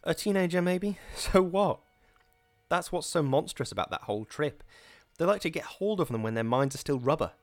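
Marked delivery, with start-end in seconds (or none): 0.0-3.7 mirthless laugh. Acted. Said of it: "She uttered a mirthless laugh".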